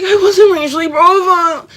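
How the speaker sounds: high, loud whiny imitation; exaggerated high-pitched protest; mocking denial. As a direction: mimic a defensive protest mockingly. Mocks the manager's defensive denial.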